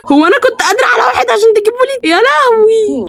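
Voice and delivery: high, rushed wail of protest; panicked complaint. Real, not acted. Panicked complaint at a second baby.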